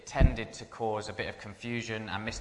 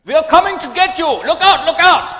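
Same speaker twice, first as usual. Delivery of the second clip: very high, very loud hammed-up yell, laughing through it; mock attacker shout; 19 dB louder than usual. Prank answer phone drama for callers, hammed-up villain shout. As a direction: bellow like a cartoon villain away from the mouthpiece.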